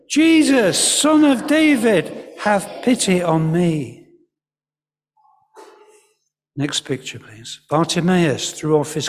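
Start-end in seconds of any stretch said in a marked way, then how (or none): 0.1-4.1 shouting out. Acted. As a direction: cry out to be heard over a crowd. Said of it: "he shouted even more".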